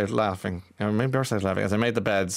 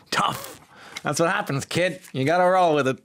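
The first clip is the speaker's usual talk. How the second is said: high, mock-tough voice; gruff mock advice; 8 semitones higher than usual. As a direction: play a tough old mentor. Voicing a tough-love reply.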